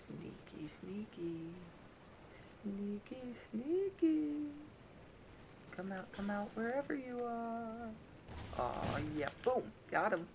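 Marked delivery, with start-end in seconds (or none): 0.1-4.3 playful creeping sing-song, rising at the end; sneaky sing-song. Pretend. Sneaking up on enemy player. 5.8-7.9 higher, teasing sing-song call; hide-and-seek taunt. Pretend. Taunting hidden enemy, hide-and-seek.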